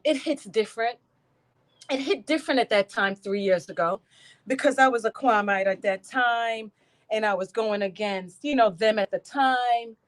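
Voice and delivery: ordinary talk, nothing marked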